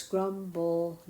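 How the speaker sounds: GRUMBLED split slowly into grum, bull, d; syllable by syllable. Shown as a demonstration. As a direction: sound out "grumbled" in pieces with the final D on its own. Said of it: "keep that D sound separate".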